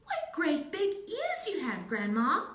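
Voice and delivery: put-on high, loud little girl's cry. A narrator's reading. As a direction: sound like a wide-eyed little girl. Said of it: "and then she said".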